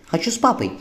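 put-on whiny, high little girl pouting; a spoiled child demanding her dad; bossy little princess. A narrator's reading. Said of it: "капризно сказала Анита".

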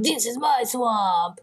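slow, drawn-out movie ogre impression; Shrek. Quoting the film to explain the swamp party, Shrek impression. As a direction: draw out each word in a put-on ogre voice. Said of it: "the Shrek parties".

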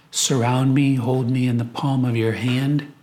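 ordinary talk

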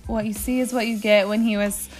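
ordinary talk, nothing marked

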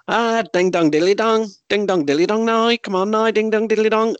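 high singsong Irish nonsense patter; imitated Irish lilt, pitched right up; comic Irish patter. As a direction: rattle off mock Irish gibberish. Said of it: "You always know Irish people".

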